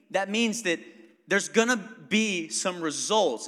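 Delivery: ordinary talk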